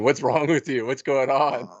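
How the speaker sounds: ordinary talk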